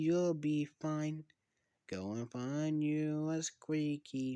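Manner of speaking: slow creepy sing-song doll taunting its prey. Acted. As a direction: taunt the victim slowly like a lullaby. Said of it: "all you hear is like this".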